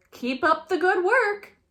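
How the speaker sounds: high, loud cheery principal; nodding principal. A narrator's reading. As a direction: approve cheerfully from the door. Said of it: "he nods".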